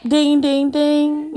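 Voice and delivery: playful bell imitation; imitated bell. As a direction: chime it out like a notification bell.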